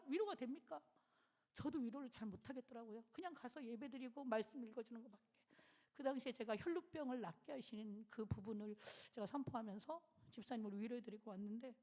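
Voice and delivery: usual speaking voice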